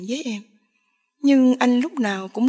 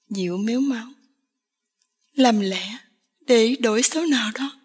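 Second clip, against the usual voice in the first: ordinary delivery, nothing marked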